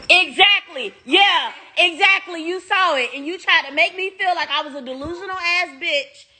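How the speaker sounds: high, animated agreeing; lively, high-pitched validation; excited agreement. Real, not acted. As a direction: jump in eagerly to agree. Talking to someone off camera.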